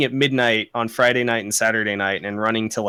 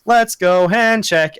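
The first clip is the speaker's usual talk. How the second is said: higher, louder, drawn-out call-out; imitated yell; 7 semitones higher, 5 dB louder, slower than usual. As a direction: mimic someone yelling across a room. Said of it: "somebody will just yell".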